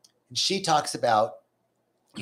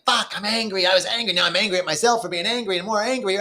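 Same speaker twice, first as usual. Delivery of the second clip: mock angry self-talk; 6 semitones higher than usual. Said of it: "I'm like".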